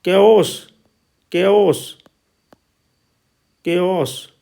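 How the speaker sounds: "केओस" with ए gliding into an open o; two-vowel glide demo. Shown as a demonstration. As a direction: repeat "केओस" as a model word, both vowels clear. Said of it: "तो आ की ध्वनि आ गई है ये". ("केओस" में दोनों स्वर साफ़)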